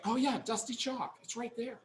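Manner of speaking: soft, high mock agreement; imagined audience agreeing. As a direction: imitate the class chiming in, small and high. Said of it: "I know you're all saying that".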